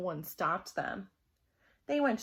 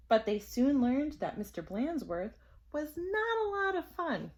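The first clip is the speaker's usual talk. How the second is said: plain reading voice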